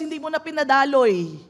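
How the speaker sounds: normal talking voice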